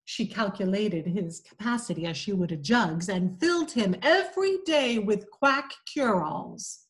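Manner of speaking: ordinary delivery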